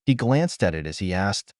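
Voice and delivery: usual speaking voice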